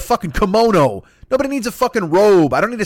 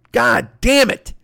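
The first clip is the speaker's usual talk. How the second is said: slow, drawn-out groan of despair; weary disgusted sigh of a curse; exasperated groan; 3 semitones higher, slower than usual. Real, not acted. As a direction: let it sag out of you. Exasperated aside.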